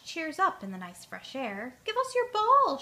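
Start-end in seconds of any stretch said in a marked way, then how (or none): 1.8-2.8 much higher, louder scream. Acted. Soccer team rabbits scream at Pig for the ball. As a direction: rabbits yell it across the field. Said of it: "she hears the scream of rabbits".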